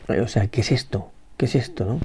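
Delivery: a sudden drop to a near hush, lost; soft, searching question in despair; hushed bewildered question. Retold or imitated. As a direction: ask it quietly, as if to yourself. Blind man's bewildered question, voiced.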